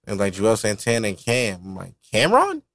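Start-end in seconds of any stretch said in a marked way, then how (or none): 2.1-2.6 surprised question. Retold or imitated. Recalling hearing the song, asks [name]? in surprise.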